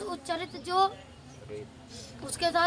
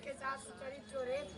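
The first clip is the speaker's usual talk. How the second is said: barely voiced, much softer repeat; quiet repeat; 12 dB quieter than usual. Real, not acted. Student to teacher, echoing the line in a whisper. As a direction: mumble it back under your breath.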